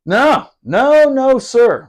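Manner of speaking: high, loud, appalled refusal; emphatic protest. Retold or imitated. Mock outrage at tentmate's underwear.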